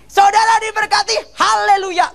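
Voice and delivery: imitated preacher's shouted blessing; very high, ringing mock pulpit cry; Gilbert blessing the crowd. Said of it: "Dia ngomong gini". (tiruan seruan berkat pendeta, nada sangat tinggi)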